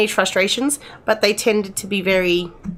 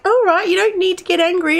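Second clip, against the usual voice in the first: daughter's voice pitched higher, mildly huffy; mimicking daughter; 11 semitones higher, 3 dB louder than usual. Mother imitating her daughter brushing off her anger, pitched higher. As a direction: brush off mum like a sulky teen. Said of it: "she's like".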